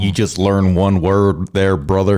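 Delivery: slow, drawled Texan; Texan accent. Shown as a demonstration. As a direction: drawl slowly like a rancher. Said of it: "Now you're crushing it".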